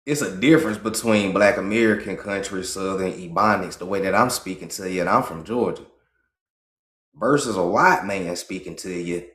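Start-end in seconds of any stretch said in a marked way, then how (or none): none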